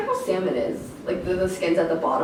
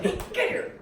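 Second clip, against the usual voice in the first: mock dad scolding; 11 semitones higher than usual.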